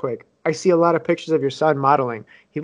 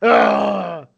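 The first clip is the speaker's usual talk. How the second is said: pirate voice; 6 semitones higher, 3 dB louder than usual. Pretend. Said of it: "a fucking pirate now".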